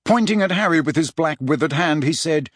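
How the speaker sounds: usual speaking voice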